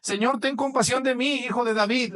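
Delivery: high pleading cry to the Lord. A narrator's reading. Woman at the border begging Jesus, crying out high. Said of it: "le iba gritando".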